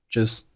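"just" with the final t unreleased; lost plosion. Shown as a demonstration. Teacher showing learners lost plosion, "just" without t burst. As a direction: end "just" on the s, t held back. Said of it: "结尾的t失去爆破".

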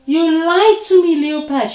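high, loud accusation; outraged storybook spider at full voice; furious shout. A narrator's reading. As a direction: shout it at the one who betrayed you. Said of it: "shouted Spider".

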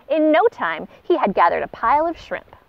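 usual speaking voice

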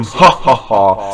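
slow, low mocking ha ha ha; deep, slow triumphant laugh; villain laugh. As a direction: gloat like a cartoon villain. Villain taunts the hero.

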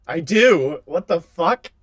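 loud shocked outburst; startled and loud. Real, not acted. Hears himself, shocked.

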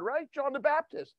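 ordinary talk

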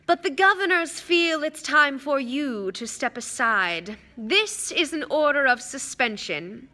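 haughty, mocking official; smug aristocratic villain, lazily superior. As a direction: savour each word with false regret. Villain's speech in narration.

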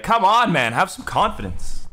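high loud taunt; exasperated call-out. Real, not acted. Taunting opponent who left.

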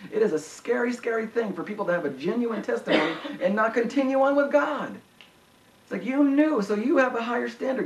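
high-pitched, urgent preacher voice; impassioned preaching, pitched well up; fervent preaching. Acted. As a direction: press the warning home from the pulpit. Preaches with rising intensity.

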